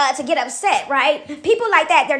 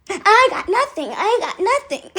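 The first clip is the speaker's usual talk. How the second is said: higher, drawn-out mock complaint; odd put-on voice; 5 semitones higher than usual. Copycat griping about getting no tips, odd put-on voice. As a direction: repeat it slowly in a put-on, higher voice. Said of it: "why are you talking like that?".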